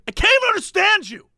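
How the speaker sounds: high-pitched exasperated outburst; indignant, strained-up mock yell; mock shouting. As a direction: imitate an irate customer, voice shooting up. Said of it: "just by shouting at people".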